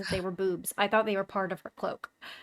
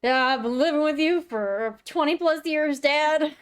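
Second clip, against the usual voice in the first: higher, teasing retort; mock kid retort; 7 semitones higher, 4 dB louder, slower than usual. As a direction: sass it back at Dad.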